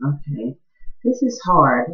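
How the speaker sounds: usual speaking voice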